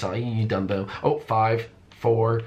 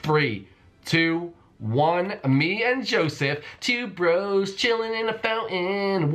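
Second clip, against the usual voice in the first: triumphant announcement; 9 semitones higher, much slower than usual. Real, not acted.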